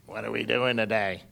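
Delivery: low little sidekick voice; Disney sidekick voice. Retold or imitated. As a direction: imitate a toddler with a low cartoon sidekick voice. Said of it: "It was a Disney sidekick voice".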